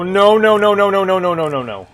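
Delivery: high panicked shouting. Real, not acted. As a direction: panic as it closes in. Chased by monster in game.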